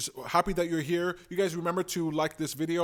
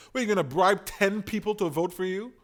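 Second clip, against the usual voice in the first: ordinary talk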